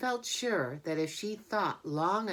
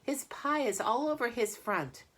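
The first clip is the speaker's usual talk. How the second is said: shouting; 8 semitones higher than usual. Acted. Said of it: "shouted Fern".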